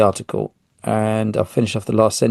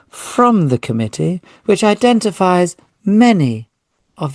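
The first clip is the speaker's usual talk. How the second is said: put-on higher, plummy BBC English accent for the quote; posh BBC voice; 5 semitones higher than usual. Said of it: "going into my B B C voice and very posh".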